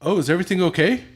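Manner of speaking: loud, high, quick mock concern; fake innocent. Dad faking innocence to his angry son. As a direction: play dumb sweetly. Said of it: "I was like".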